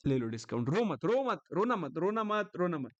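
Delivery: put-on teasing comfort, as to a crying child; mock consoling. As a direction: pat her on the head with words, playfully. Consoling pretend crying student. (रोती छात्रा को चिढ़ाते हुए दिलासा)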